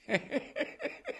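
high, light chuckle; soft little laugh of amusement. Real, not acted. Retired investigator recalling how he found the gold, chuckling.

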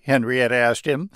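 plain reading voice

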